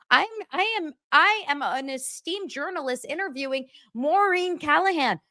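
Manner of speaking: high, haughty mock journalist voice; mock self-important. Host mocking her own self-importance, haughty and high. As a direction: puff yourself up, ham it.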